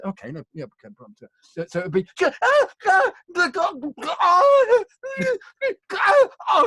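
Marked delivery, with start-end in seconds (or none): 2.2-6.5 very high, loud, slow drawn-out dying wails; slow death cries. Shown as a demonstration. Director asks the actor for a slower death, slow dying cries. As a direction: die slowly, stretching every cry. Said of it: "die a bit more slowly".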